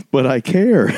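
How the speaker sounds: high-pitched, playful mock plea; mock protest. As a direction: plead like a guiltily invested viewer, half laughing. Voicing a guiltily invested viewer.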